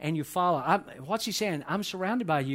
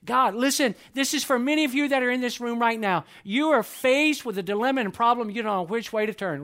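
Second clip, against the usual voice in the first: louder, higher urgent appeal to the room; fervent preaching; 8 semitones higher, 6 dB louder than usual. Real, not acted. Pastor urging his congregation in a room, fervent and loud. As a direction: lean in and press the point on them.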